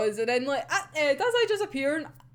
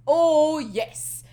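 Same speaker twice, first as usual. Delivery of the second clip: ordinary talk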